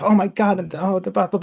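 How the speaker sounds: replaying her ranting in a half-serious way; retold rant. Retelling her rant after the flea circus, mock-angry. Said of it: "I just ranted on the whole way back".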